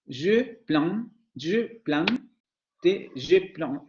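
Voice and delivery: "je plante" with an oral vowel plus n; southern French. Shown as a demonstration. Teacher demos southern speakers' pronunciation. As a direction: repeat "je plante", n sounded, vowel not nasal. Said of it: "pronunciarían esa parte como".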